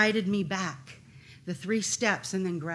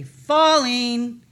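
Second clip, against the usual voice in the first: loud, drawn-out shout; demonstrated warning call; 3 semitones higher, 10 dB louder than usual. Retold or imitated. Climber warns people below, loud stretched call. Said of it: "you say, 'Falling,'".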